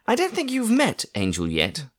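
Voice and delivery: indignant aunt telling everyone off; exasperated aunt. A narrator's reading. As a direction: tick off the grown-ups like a fed-up aunt. Aunt introduces Angel.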